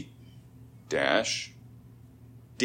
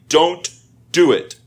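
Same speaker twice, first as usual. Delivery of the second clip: "Don't" and "do it" high and loud, like a hard command; strongest prohibition; 7 semitones higher, 7 dB louder than usual. Shown as a demonstration. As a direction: bark the ban as firmly as possible. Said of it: "This is the strongest way of saying".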